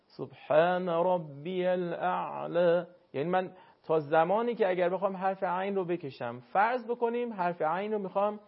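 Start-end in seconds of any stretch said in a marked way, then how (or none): 0.0-2.9 سبحان ربی الاعلی recited slowly with the ع in الاعلی stretched but unvoweled; slow careful recitation; held sukun. Shown as a demonstration. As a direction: stretch the ع of الاعلی without moving it.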